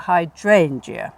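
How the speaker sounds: very slow "hydrangea", DRAIN drawn out; hammed-up hy-DRAIN-gea, stretched; overdone stress on DRAIN. Shown as a demonstration. Teacher exaggerating the stress for a student: hy-DRAIN-gea. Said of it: "going over the top".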